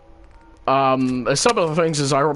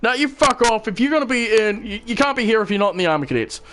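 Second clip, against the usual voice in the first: raised-pitch, indignant retold rant; angry telling-off; 7 semitones higher, faster than usual. Cadet banishing the teller from the group, angry. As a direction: imitate him angrily sending you away. Acted quote of hostile cadet.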